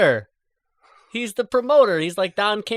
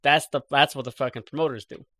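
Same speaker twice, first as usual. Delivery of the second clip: normal talking voice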